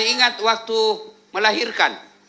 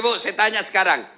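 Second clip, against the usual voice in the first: ordinary talk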